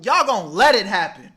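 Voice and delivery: loud, pressing scold; emphatic scolding. Real, not acted. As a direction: hammer it home to them. Scolding the audience.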